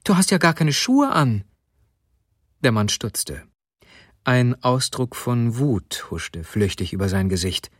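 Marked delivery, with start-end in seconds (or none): 0.0-1.4 raised-pitch exclamation; exclaiming. A narrator's reading. Wife to her shoeless husband, exclaiming. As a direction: call it out in astonishment. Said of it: "rief sie aus".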